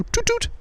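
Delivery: very high toot toot; imitated tooting. Comic playing a drive-by tooter, high imitated horn. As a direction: mimic a cheery little horn. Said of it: "the occasional drive-by tooting".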